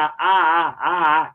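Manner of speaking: "a, a" as separate repeated open vowels; clashing repeated vowel. Shown as a demonstration. Teacher mocking the ugly sound, repeated clashing "a, a". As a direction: repeat "a, a" to expose the clumsy clash. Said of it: "a fica feio".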